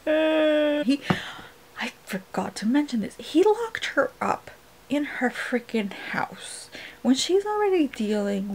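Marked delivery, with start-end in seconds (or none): 1.8-8.5 whisper. Real, not acted. Ranting to viewers about Tamlin, whispered. Whispering while parents home.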